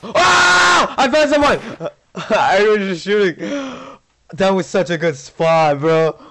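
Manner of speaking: high, loud laughing yell; excited shout with laughter. Real, not acted. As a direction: shriek with delight then laugh. Finds hidden player, laughing.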